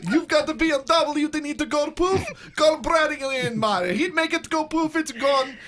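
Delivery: loud, high, hammed-up Russian accent; mock Russian accent. Happy customer plugging Bradley on air, mock Russian accent. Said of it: "you just did that in an accent".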